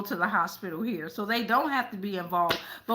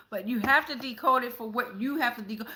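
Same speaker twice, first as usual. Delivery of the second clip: ordinary talk, nothing marked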